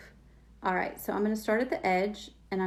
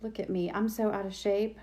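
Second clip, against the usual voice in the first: ordinary talk, nothing marked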